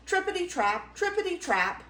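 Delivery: high, bouncy rhythmic chant; clattering hoofbeats. A narrator's reading. Middle Gruff clattering over the bridge, high patter.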